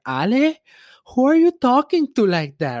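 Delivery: high, loud motherly alarm; imitated terrified mom. Mom overhearing her son's gaming rant, imitated as terrified. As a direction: put on an appalled mother's voice. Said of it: "when my mom came in".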